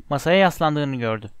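plain reading voice

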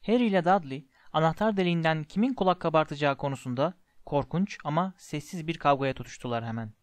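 usual speaking voice

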